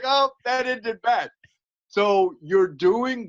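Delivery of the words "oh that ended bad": mock dazed fighter.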